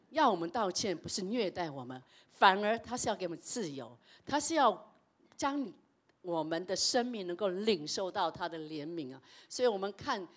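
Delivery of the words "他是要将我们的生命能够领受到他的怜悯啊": usual speaking voice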